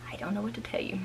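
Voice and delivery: breathy exasperated whisper. Real, not acted. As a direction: drop to a whisper, giving up. Exasperated aside after driving test.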